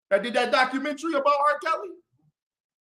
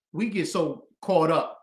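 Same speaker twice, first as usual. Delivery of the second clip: ordinary delivery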